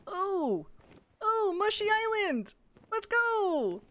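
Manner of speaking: high, bright delighted cry; thrilled discovery, voice leaping up; excited squeal. Real, not acted. She finds a mushroom island and squeals high with joy.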